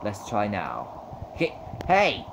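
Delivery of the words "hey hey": high, loud challenge; confrontational. Real, not acted. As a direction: shout them down, then crow in triumph. Player confronts someone in game.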